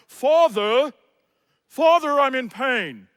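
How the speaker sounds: loud, stiffly proper complaint; mock formal child. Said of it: "my son was not running across the yard going".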